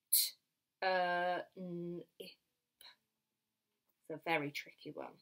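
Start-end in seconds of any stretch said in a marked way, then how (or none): 0.1-2.3 "T, uh, n, ip" as separate sounds; sounded out. Shown as a demonstration. Teacher segments a word for children, sounded out "T, uh, n, ip". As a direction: say "T, uh, n, ip" sound by sound, with gaps. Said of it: "only makes one sound".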